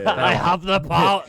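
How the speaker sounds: hammed-up He-Man power boast to tease the chat. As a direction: proclaim like a cartoon muscle hero. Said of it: "What are you, He-Man?".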